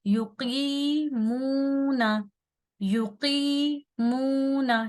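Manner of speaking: "युकीमूना" slow, with long qee and moo; recitation with madd. Shown as a demonstration. Teacher reciting an example for learners, stretched vowels. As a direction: hold the two middle vowels of "युकीमूना" on level notes. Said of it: "हम पढ़ते हैं".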